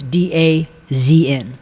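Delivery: "D A Z N" in separate letters; spelled out. Shown as a demonstration. Host helping listeners find the name, "D A Z N" letter by letter. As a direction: spell "D A Z N" slowly for the audience. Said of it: "if you're listening, it's".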